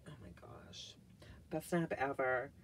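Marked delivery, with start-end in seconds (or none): 0.1-1.0 breathless unvoiced whisper of awe; awed whisper. Real, not acted. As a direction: breathe it out as if stunned by the smell. Sniffing candle, awed.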